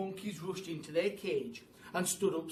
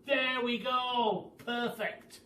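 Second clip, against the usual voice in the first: high loud satisfied cry; triumphant shout; 7 semitones higher, 5 dB louder, much slower than usual. Acted. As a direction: cheer it out. Job done.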